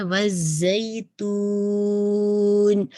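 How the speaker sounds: وزیتون held long with a light nasal غنہ on the final نون; nasal ending. Shown as a demonstration. Teacher modelling the غنہ at the end of وزیتون. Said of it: "نون کا غنہ add".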